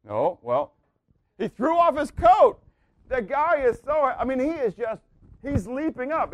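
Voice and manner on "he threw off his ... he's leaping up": high, bursting with eagerness; breathless thrill of a man jumping up; excited. Acted. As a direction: sell the leap like pure joy. Preacher acts out eager blind man.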